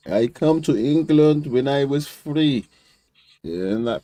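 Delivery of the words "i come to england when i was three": high, slow Iranian accent mimicry; slow broken English in a mock Iranian accent. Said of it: "in his Iranian accent".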